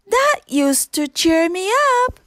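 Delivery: a small cup remembering happy times; little teacup Chip. A narrator's reading. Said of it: "Chip chimed in".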